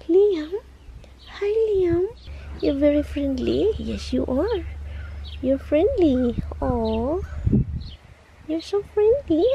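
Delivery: high, sing-song praise for the cat. Real, not acted. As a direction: gush over the kitty.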